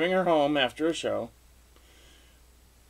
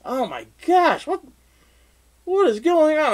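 high, exclaimed disbelief; mock alarm, pitched way up; startled, acted out; 13 semitones higher, 4 dB louder than usual. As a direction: cry out as if woken by a commotion. Acts out waking startled to honking.

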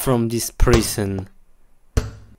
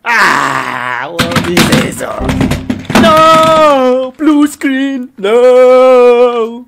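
much louder, higher screams of no; despairing yell; 10 semitones higher, 12 dB louder, much slower than usual. Real, not acted. Gamer screams as his PC hits blue screen, loud despairing yell. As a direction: scream as the computer dies.

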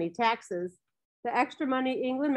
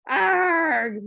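loud, high pirate war cry; pirate shout; 6 semitones higher, 5 dB louder than usual. A narrator's reading. Small boy waves his stick sword at his friend, pirate shout. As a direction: roar it out like a pirate. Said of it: "shouted out in his most pirate-like voice".

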